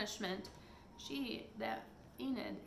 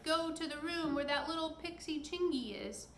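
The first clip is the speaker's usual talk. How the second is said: crying out; 6 semitones higher, 3 dB louder than usual. Acted. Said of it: "and Peter cried".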